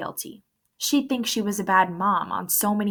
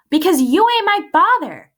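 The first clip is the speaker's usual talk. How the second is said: loud, higher angry snap; 11 semitones higher, 10 dB louder than usual. A narrator's reading. Ghost snapping back at Coach, loud and angry. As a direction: throw it at him like a slap. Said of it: "I snapped".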